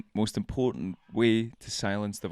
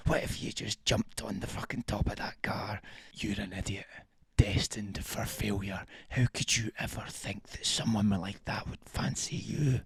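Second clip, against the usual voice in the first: hissed unvoiced whisper of a cruel inner critic; sneering whisper; 5 dB quieter than usual. The inner critic whispers insults at you, hushed and cruel. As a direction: whisper the insults like a nasty voice in your head.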